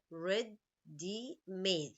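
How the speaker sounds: very slow, syllable by syllable; split into syllables. Shown as a demonstration. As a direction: pull ready-made apart, each syllable separate. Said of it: "How many syllables here?".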